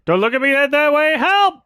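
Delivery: high, pleading mockery of a scared host; hammed-up mock plea; mock panicky John Oliver. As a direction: squeak it out like a cornered comic. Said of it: "John Oliver is like".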